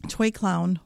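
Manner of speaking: ordinary delivery, nothing marked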